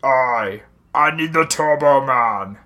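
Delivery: put-on slow, drawn-out Arnold accent; deliberate mock Arnold accent; Schwarzenegger accent. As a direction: say it as a measured Arnold accent impression. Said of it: "Arnold Schwarzenegger".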